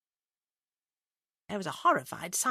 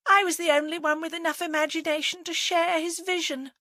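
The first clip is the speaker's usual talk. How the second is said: tearful adoration; 10 semitones higher, 3 dB louder than usual. A narrator's reading. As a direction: gush with grief, pitched well up. Dabs eyes, mourns dead lover.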